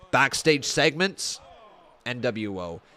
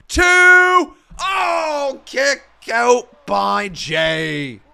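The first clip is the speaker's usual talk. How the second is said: high, loud ringside calling; play-by-play shouting; 14 semitones higher, 13 dB louder, slower than usual. Acted. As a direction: scream the big spot live. Live wrestling play-by-play.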